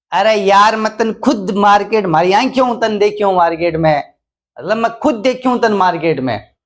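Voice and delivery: emphatic dialect insistence; insisting with emphasis. Shown as a demonstration. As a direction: insist you saw him yourself. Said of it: "अब मैंने जोर लगाया है ना".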